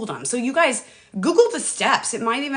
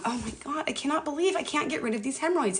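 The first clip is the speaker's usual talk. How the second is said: softer, higher, mock moaning; half-hearted moan about it; mock despair; 5 semitones higher, 7 dB quieter than usual. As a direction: whine as if despairing. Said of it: "instead of focusing on".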